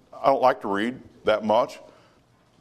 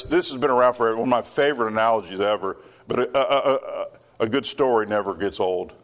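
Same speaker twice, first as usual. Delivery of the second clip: usual speaking voice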